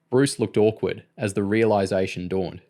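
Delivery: usual speaking voice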